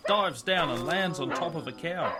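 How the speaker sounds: plain reading voice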